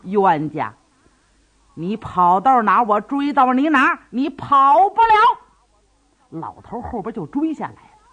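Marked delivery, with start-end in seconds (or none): 0.0-5.4 put-on old man shouting after the fleeing man; chasing shout. A narrator's reading. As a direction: call after the runaway, growing fiercer.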